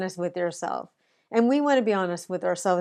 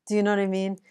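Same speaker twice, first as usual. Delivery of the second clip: ordinary talk, nothing marked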